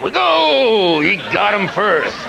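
high wail slowing into drawn-out words; hammed-up protest from beaten competitors; mock outraged rivals. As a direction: whine it slowly, starting high. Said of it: "Letterman, Leno, they're like".

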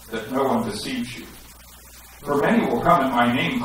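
ordinary talk, nothing marked

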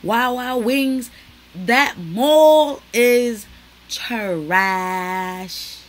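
loud and drawn out, hammering each word; slow, loud mock outrage at a bad mall; loud rant. Real, not acted. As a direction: blast the verdict at the camera. Ranting to camera about the mall.

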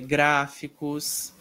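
normal talking voice

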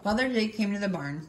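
plain reading voice

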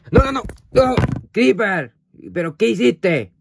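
high, loud shocked yell; shocked shout. Real, not acted. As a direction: cry out in alarm at the mess. Creeper knocks something over. (grito de sorpresa y alarma)

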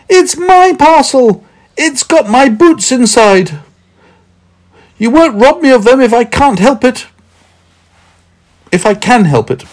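high, loud indignant protest; shouting back. A narrator's reading. Meddle defending his boots across the stream, shouting. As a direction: yell it across, far above normal pitch. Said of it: "Meddle shouted back".

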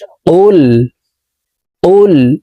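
"কুল" with q from the tongue root; held "কুল", tongue root against the palate; deep q. Shown as a demonstration. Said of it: "জিহ্বার গোড়া তার বরাবর উপরের তালুর সঙ্গে লাগাইয়া".

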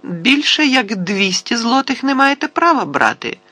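very high, innocent voice; high-pitched, guileless objection; innocent little voice. A narrator's reading. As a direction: argue in a thin, high little voice. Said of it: "тим самим невинним голоском".

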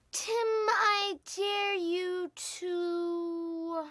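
very slow, every word stretched; drawn-out suspense. Acted. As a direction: announce the dare with long held words. Drawn-out suspense in dare game.

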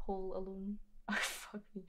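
breathy whispered curse; whisper to self. Real, not acted. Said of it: "whispered to herself".

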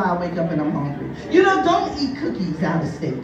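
ordinary delivery

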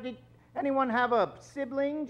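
usual speaking voice

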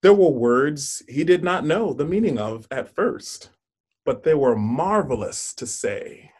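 put-on storybook narration for children; story reading. Teacher reads picture book aloud.

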